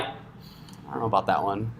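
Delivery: low, very soft aside; hesitant mumble. Acted. Sister or brother hearing tech jargon, hesitant mumble. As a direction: mumble it low, as a puzzled listener. Voicing a baffled relative's reply.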